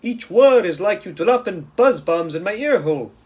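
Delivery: high, loud pleading giant. A narrator's reading. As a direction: beg her to stop, hurting.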